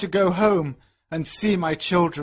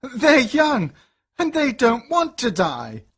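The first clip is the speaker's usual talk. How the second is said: higher, desperate plea; pleading; 7 semitones higher than usual. A narrator's reading. Father pleads for his daughters, high desperate plea. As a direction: beg for the children.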